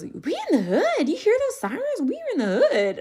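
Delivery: put-on high, excited child voice; alarmed kid. Another kid on the bus exclaims to the others, high and alarmed. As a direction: blurt it like a kid spooked by sirens.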